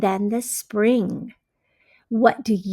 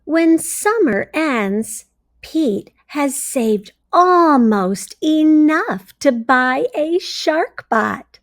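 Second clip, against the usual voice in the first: bright, expressive storybook read-aloud; reading to children; 2 semitones higher, 5 dB louder, slower than usual. A narrator's reading. Teacher reading a story to her class, expressive read-aloud. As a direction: read it like a teacher sharing a picture book.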